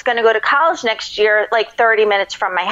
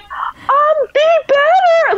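high, choked words through tears; sobbed out high; tearful crying; much slower than usual. Retold or imitated. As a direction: imitate herself blubbering, much higher.

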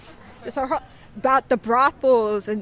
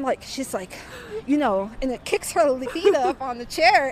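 normal talking voice

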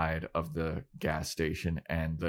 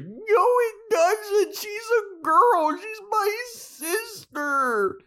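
very high drawn-out squeal; slow, squealed mock outrage; indignant mock protest; 4 dB louder, much slower than usual. Buffy protests in acted quarrel.

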